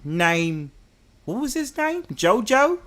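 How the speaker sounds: high, soft put-on London voice; London accent. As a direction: play a nosy Londoner. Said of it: "should I speak in my London voice".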